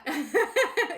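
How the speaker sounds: loud, high laugh breaking out; burst of laughter. Real, not acted. Laughing at the listener for making her emotional, loud high laugh. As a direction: laugh it off after feigning tears.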